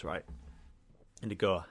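ordinary delivery

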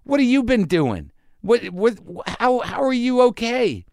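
high, disbelieving questions to a stray cat; high astonished questioning; incredulous. Retold or imitated. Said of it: "part of me that's like".

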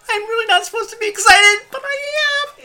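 high squeaky thrilled voice; giddy excitement. Acted. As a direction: squeal with barely held-back joy. GM voices excited flying character.

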